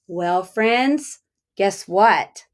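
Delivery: ordinary talk, nothing marked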